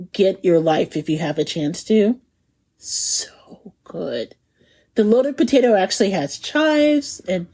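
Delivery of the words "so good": whispered, unvoiced So good. Real, not acted. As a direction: breathe it like a secret delight. Whispered praise.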